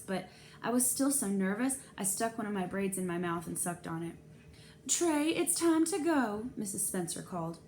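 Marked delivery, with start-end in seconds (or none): 4.9-6.5 slow, higher, louder call across the library. A narrator's reading. Mrs Spencer calls her son to leave, slow and loud.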